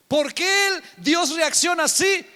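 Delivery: high, loud rousing question; preaching shout. Real, not acted.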